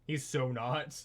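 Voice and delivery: ordinary talk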